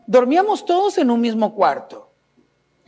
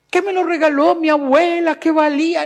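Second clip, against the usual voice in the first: higher, louder mock complaint; fussy parent protesting; 7 semitones higher, 6 dB louder than usual. Preacher mimicking a parent upset over a broken heirloom, mock protest. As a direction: whine about the broken heirloom.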